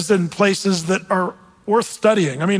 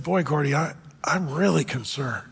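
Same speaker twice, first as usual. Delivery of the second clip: mock serious; 5 semitones lower, 6 dB quieter than usual. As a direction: lower and softer, mock-grave. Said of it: "Tad got very, very serious".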